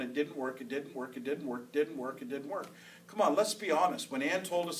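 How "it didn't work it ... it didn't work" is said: low, quiet doubting refrain; doubting inner voice. Acted. The mind doubting while pleading the blood, low nagging murmur. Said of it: "your mind will be going".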